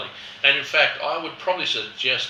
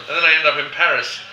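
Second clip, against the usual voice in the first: ordinary talk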